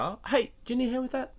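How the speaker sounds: high, fast chirpy helper voice; customer service voice. Shown as a demonstration. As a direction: chirp an offer of help, quick and high. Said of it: "or you just walk up to someone".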